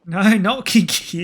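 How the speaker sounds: laughing through the protest. Real, not acted. Laughs at friend's teasing.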